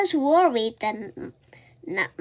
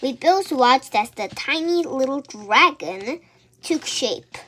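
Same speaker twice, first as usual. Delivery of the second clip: ordinary delivery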